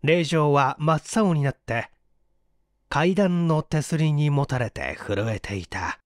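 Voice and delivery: ordinary delivery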